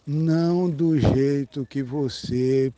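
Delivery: very slow, measured words; deliberate, drawn-out closing point; slow pointed warning. Real, not acted. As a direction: stretch out each word so the listener takes it in. Slow warning after asking close attention.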